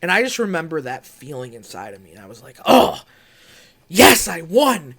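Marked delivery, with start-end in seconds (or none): 2.7-4.9 elated. Retold or imitated. Savouring the victory alone.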